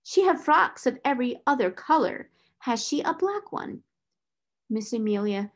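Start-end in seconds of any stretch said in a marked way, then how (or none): 0.0-3.8 high, pressing demand; impatient questioning. Acted. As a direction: press her for an answer. Stern interrogation of flustered sister.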